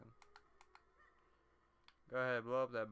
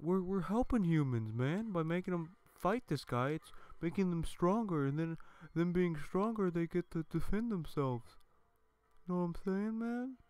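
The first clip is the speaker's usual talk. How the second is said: higher-pitched pleading creature; mock Picori voice; 6 semitones higher, 4 dB louder than usual. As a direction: voice a little creature making excuses, pitched up. Voicing the Picori defending themselves.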